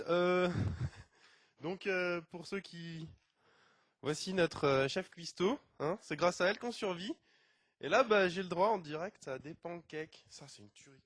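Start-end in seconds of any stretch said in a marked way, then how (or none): none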